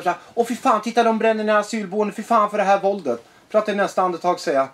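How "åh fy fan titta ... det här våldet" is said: higher-pitched indignant quote; mock outrage. Mimicking the appalled left over burning asylum housing, mock outrage. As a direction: act out someone appalled, pitched up.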